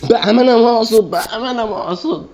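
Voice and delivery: very high, put-on whiny plea; mock pleading. Gamer pleading with the game, squeaky and high. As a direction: beg in a squeaky cartoon voice.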